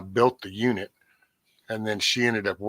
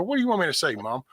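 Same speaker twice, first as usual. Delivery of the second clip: exasperated retort; 6 semitones higher, much faster than usual. Retold or imitated. Retold reply to mom.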